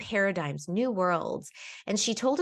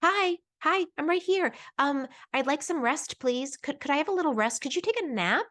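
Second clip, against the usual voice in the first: much higher, small timid voice asking politely; a tiny hopeful child voice, then giving in; little inner voice; 7 semitones higher than usual. Retold or imitated. Inner voice asking for rest, much higher timid child voice. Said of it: "voice that's trying to be like".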